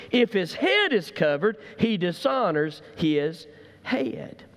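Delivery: rising preacher's paraphrase of the verse; preaching paraphrase. Acted. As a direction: press the paraphrase home to the congregation.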